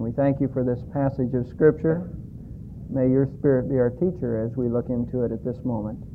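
soft, reverent closing prayer; quiet prayer. Real, not acted. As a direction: pray gently before the sermon. Pastor's opening prayer.

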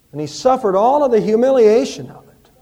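full-force pulpit passion; fervent preaching. Acted. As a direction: hammer each word of the suffering home. Preacher's rising passion on suffering.

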